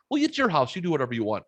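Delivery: high-pitched start, easing down, mock mom; retold mom. Son retelling his mom's answer about smoking, imitated mom voice. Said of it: "oh, she goes".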